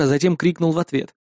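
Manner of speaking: usual speaking voice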